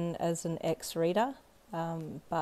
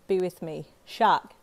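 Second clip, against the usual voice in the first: usual speaking voice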